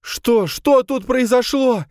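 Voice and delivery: very high, loud, drawn-out wail; anguished moan. A narrator's reading. Camille asking the gendarme what happened, anguished moan. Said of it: "простонала Камила".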